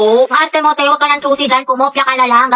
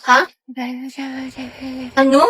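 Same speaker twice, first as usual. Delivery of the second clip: ordinary talk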